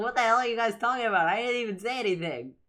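high, loud, fast mock indignation; mock exasperated outburst. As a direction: blurt it out loudly, as if baffled by the room. Acted exasperated reaction to muttering.

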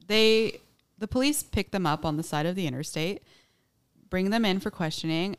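usual speaking voice

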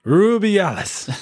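high, slow Spanish-style Rubiales with a rolled R; Spanish accent. Shown as a demonstration. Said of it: "Where's your Spanish accent".

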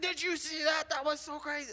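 sports fan's high, excited burst; excited texting. Retold or imitated. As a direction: gush over a big play. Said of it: "I'm gonna be like".

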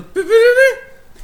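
high, slow put-on squeaky voice; high drawn-out cartoon voice; character catchphrase. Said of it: "cuántos putos años diciendo".

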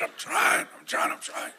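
voiceless, strangled rasp; imitated choking. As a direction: gasp it out as if being throttled. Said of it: "a hold kept getting tighter and tighter".

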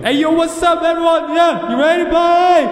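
high loud hype-man shout; club hype man. Pretend. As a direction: hype up the crowd. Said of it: "could be like that".

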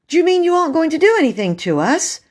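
high, fast incredulous question; astonished relief. Acted. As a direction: ask in breathless-paced disbelief. Child astonished at reprieve.

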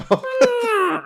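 high, botched elephant trumpet; badly faked elephant call; failed elephant impression. Shown as a demonstration. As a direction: try to trumpet and miss. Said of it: "I can't even do an elephant now".

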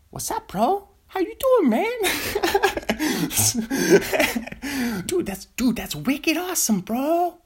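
high-pitched mock Boston bro; hammed-up Boston dude, breaking into laughs; Boston accent. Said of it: "making fun of the Boston accent".

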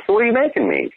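put-on very high, bouncy childish ask; goofy kid voice asking about dinner; playful kid voice. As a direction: squeak it like a giddy kid. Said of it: "the walk away".

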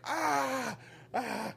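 high, strained yelps like a hurt wrestler; mock pained scream. Wrestler mocking a body-slammed opponent with a high pained yelp. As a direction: squeal as if you just got stabbed. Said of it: "screams like he just got stabbed".

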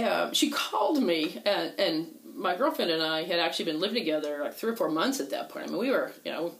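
normal talking voice

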